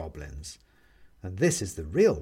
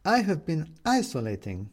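Renata's voice; 6 semitones higher than usual. A narrator's reading. Renata speaks in the story.

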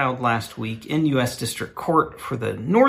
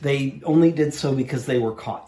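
normal talking voice

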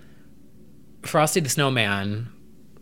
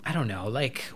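a breathy unvoiced aside; soft whispered hesitation; 3 semitones lower, 6 dB quieter than usual. Real, not acted. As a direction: drop to a whisper mid-thought. Aside while musing.

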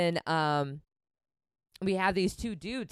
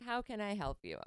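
mock-polite and much softer, dripping with smugness; sweetly smug, like you know more than the cops do; condescending and cocky; 11 dB quieter than usual. To police officers at her car window, smug mock politeness. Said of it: "Just real condescending and cocky".

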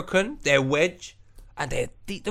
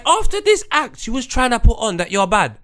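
retold telling-off; 8 semitones higher, 9 dB louder than usual. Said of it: "So I said to the guy".

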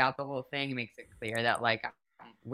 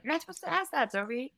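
much higher, mock-scolding friend voice; a friend hissing a warning, pitched way up; 12 semitones higher than usual. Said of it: "my friend was like".

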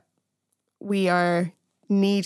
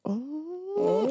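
high, soft, long-drawn ooh; awkward cringe; 10 semitones higher, 8 dB quieter than usual. Real, not acted. Podcaster reacting to a friendship snub, cringing high and soft. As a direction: wince through a drawn-out high 'oh'.

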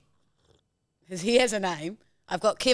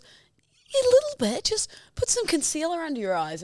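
imitated housemate; 10 semitones higher than usual. Housemate breaking it to her that she looks tired, high-pitched imitation. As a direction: lift it way up, imitating a tactful friend.

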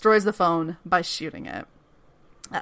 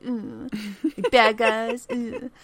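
toying mock villain; 5 dB quieter, much slower than usual. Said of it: "his little fucking vocal delivery, just toying with".

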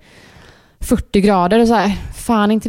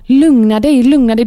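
louder, insistent quoted command; imitated firm order; 2 semitones higher, 5 dB louder than usual. Partner on the phone to a panicking mum, imitated firmly. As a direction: act out a partner cutting through the panic. Acting partner telling her to calm.

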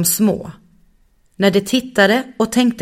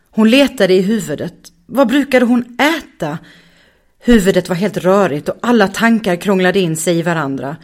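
ordinary delivery, nothing marked

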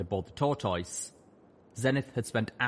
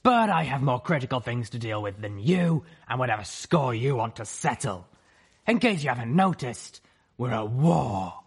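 stern griffin voice; 3 semitones higher, 4 dB louder than usual. Acted. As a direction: call out in a hard female voice.

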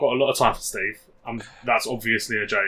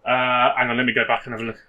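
ordinary talk, nothing marked

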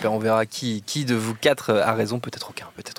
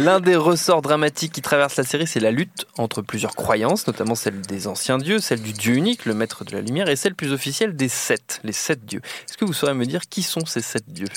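deliberate quiz-master reading; quiz host read. Acted. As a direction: pose the quiz question like a game show host. Host reads quiz question.

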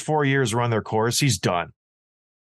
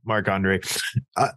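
normal talking voice

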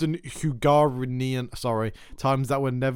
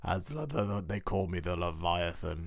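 disembodied voice; 6 semitones lower, 5 dB quieter than usual. Pretend. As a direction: speak like a hidden sea giant. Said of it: "Where is that voice coming from?".

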